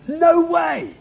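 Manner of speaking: jumps high, incredulous no way; high, scoffing rejection; emphatic no way. Pretend. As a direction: toss it off like an outraged modern listener. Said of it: "We might say today".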